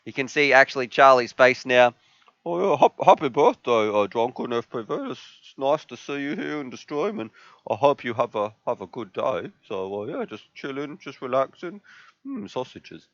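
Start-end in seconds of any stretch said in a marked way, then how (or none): 2.4-13.1 soft, relaxed voice for the dog; dog thanking a viewer for birthday wishes, softly; puppy talking. Pretend. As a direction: speak for the puppy, hushed and lazy. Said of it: "I like how you imitate your puppy talking".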